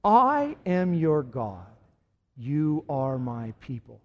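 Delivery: slow, deliberate call for repetition; slow leading recital. Shown as a demonstration. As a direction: say each word slowly for the congregation to echo. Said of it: "Say it again".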